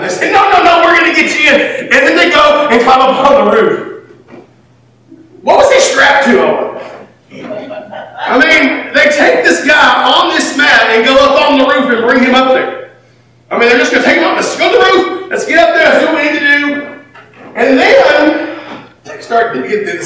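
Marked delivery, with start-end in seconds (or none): 0.3-1.9 eager insistence. Pretend. Friends insisting. 14.3-16.9 eager rallying. Pretend. Friends planning roof.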